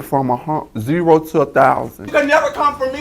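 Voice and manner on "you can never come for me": high, haughty diva proclaiming; lightly voiced diva, lifted high and grand. [name] tells the group off as the diva. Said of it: "I am the diva one oh one".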